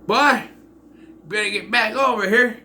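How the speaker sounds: put-on high, loud Southern accent; Michael Rooker's accent. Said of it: "he has that kind of accent when he talks".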